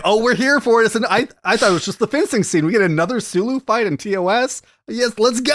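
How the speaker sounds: high, excited fan retelling. Retold or imitated. Fan retelling getting another Sulu fight, excited. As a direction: relive being thrilled, pitched well up.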